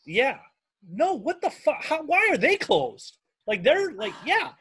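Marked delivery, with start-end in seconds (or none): 0.9-3.2 high-pitched, slowing disbelief; incredulous outrage. Real, not acted. Host hearing the good drive-in is closed, incredulous. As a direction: demand an answer, appalled and high.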